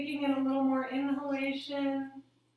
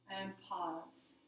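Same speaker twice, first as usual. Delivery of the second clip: very soft, lowered voice; hushed settling cue; 6 semitones lower, 11 dB quieter than usual. Shown as a demonstration. Yoga teacher resting the class after exhaling, hushed and low. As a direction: sink down and rest at the bottom of the breath.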